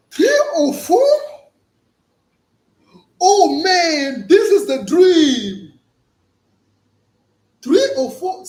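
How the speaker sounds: loud, high thrilled shouting; can't believe his luck; overexcited American guy. Retold or imitated. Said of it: "The American guy is like".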